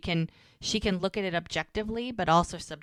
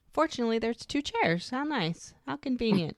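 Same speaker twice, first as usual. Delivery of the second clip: higher, mock-delighted commentary; tongue-in-cheek; 6 semitones higher than usual. Real, not acted. Podcaster teasing co-host about two chairs by the river, tongue-in-cheek. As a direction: feign delight at a handy coincidence. Wry aside on the show's staging.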